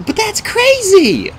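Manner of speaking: high, loud, slow outburst; distressed cry. A narrator's reading. Girl protests her mentor's firing, crying out. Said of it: "Annabeth cried".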